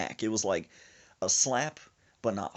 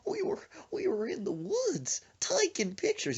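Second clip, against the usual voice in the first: high, breaking, scared falsetto; Gomer Pyle. Retold or imitated. Scared friend explains to dad, high Gomer Pyle imitation. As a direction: panicky twangy mimicry in a very high voice. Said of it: "Why are you talking like Gomer Pyle".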